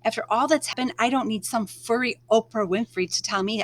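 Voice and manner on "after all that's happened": ordinary delivery, nothing marked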